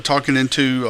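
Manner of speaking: usual speaking voice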